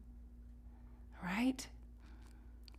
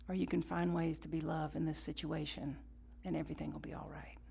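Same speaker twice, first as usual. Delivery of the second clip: calm mentor voice; 4 semitones lower, 13 dB quieter than usual. Retold or imitated. Wise mentor's calm counsel.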